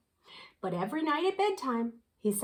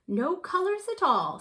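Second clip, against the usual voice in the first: sniffing; 7 semitones higher than usual. Acted. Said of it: "sniffed the dotty red".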